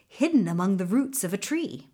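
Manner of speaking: usual speaking voice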